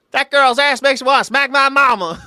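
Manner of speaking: South Carolina accent, exaggerated; Southern accent. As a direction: imitate a South Carolina buddy, hammed up. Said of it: "the first time I heard that".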